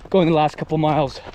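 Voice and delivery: ordinary talk, nothing marked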